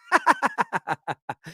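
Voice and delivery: high-pitched burst of laughter; real, high, rapid laugh. Real, not acted. Host amused by a fan's comment, high laugh.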